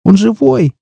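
put-on very high girlish squeal; squeaky, panicked girl; frightened squeak. A narrator's reading. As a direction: squeak in alarm, pitch way up. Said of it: "пискнула девушка".

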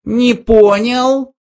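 high, slow threatening mouse; menacing. A narrator's reading. Benjy threatens the toasters, high slow menace. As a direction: menace in a tiny voice. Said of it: "грозно сказал Бенджи".

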